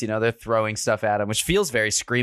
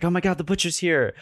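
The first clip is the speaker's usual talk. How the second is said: mock freak-out; 8 semitones higher, faster than usual. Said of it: "Ryler freaks out".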